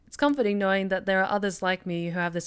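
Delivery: calm first-person narration read aloud; story narration. A narrator's reading. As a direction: read the game text as a storyteller. Reads visual novel narration.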